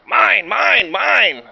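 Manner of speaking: loud, high roar of mine; greedy dinosaur. Acted. Greedy dinosaur claiming toys, loud high roar. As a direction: bellow it like a spoiled dinosaur.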